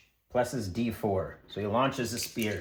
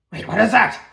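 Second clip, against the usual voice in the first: high, loud startled question; alarmed Wolf Shadow; 10 semitones higher, 10 dB louder, faster than usual. Acted. Wolf Shadow as the body stirs, loudly alarmed. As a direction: burst out in sudden alarm. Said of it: "Wolf Shadow is like".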